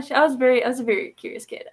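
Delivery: ordinary delivery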